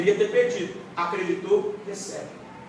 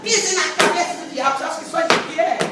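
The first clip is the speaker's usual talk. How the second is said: hammed-up mock of shouting believers, much higher; mocking crowd; 9 semitones higher, 5 dB louder than usual. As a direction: parody the showy believers stomping on the devil. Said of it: "Tá cheio de gente".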